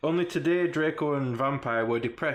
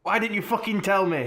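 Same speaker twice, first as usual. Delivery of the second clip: mild mock shout, higher-pitched; 6 semitones higher, 3 dB louder than usual. A narrator's reading. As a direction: read it as a half-hearted angry shout. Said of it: "he shouted".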